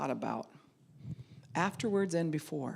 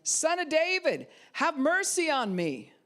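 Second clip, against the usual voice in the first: a desperate cry, much higher and louder; shouting louder; 12 semitones higher, 5 dB louder than usual. Acted. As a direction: beggar shouts over the crowd again, louder plea. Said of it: "he only shouted louder".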